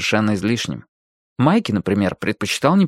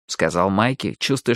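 ordinary delivery

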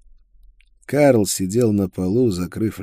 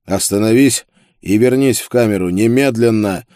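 loud, commanding order; firm command; 3 semitones higher, 7 dB louder than usual. A narrator's reading. Prisoner orders Isolde back to the cell, firm and loud. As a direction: give a hard order that allows no argument. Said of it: "Мой голос звучал твёрдо".

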